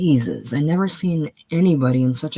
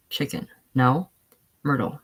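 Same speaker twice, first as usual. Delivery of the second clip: normal talking voice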